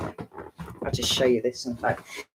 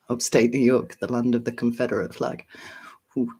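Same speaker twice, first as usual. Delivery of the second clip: ordinary talk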